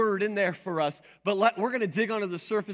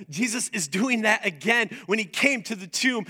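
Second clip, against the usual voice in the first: ordinary talk, nothing marked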